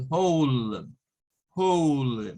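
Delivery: "whole" with a long gliding "ou"; long O sound. Shown as a demonstration. Teacher models a vowel for students, "whole" with long O. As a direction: draw out the O in "whole", falling. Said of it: "es un sonido largo".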